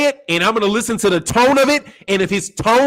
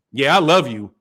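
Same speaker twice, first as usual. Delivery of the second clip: contemptuous; 7 semitones lower than usual. Shown as a demonstration. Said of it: "That means fuck you".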